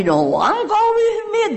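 pitching up to imitate someone sighing she is alone; lonely lament. A friend complaining nobody goes with her, high imitated lament. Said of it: "somebody told me".

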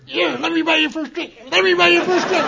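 high, loud mocking old drinker's voice; Rotten Ralph taunting. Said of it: "is Rotten Ralph over his shoulder".

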